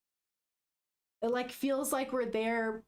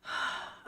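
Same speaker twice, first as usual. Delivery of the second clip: long, steadying exhale; heavy calming sigh; deep breath; 4 dB quieter than usual. Real, not acted. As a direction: breathe out deeply to regain composure. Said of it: "Deep respirate".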